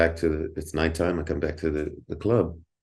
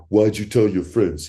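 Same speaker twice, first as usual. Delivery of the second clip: louder, higher accusing challenge; accusing bouncer; 5 semitones higher, 5 dB louder than usual. Retold or imitated. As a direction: throw the question back at him, confronting. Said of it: "He was like this".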